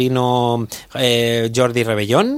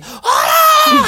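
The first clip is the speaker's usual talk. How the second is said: sudden high shout of "¡Hola!"; 6 dB louder than usual. Retold or imitated. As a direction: yell "¡Hola!" way up high like an old lady in the crowd. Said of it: "así como gritando".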